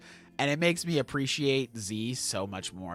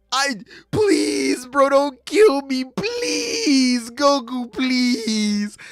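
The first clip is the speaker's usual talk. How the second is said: high, loud, drawn-out whiny plea; hammed-up wail for mercy; mock desperate begging; 11 semitones higher, 6 dB louder, much slower than usual. Raditz begging Goku to spare him, hammed-up pleading.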